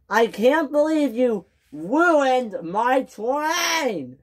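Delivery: high, slow, outraged complaint; angry. Acted. James blaming Thomas for his ruined train, angry.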